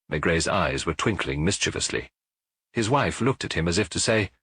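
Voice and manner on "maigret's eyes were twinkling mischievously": usual speaking voice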